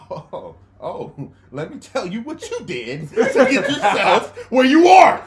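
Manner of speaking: shouting a lecture while laughing; loud, slow know-it-all rant, laughing; mock lecture shout. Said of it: "You're like".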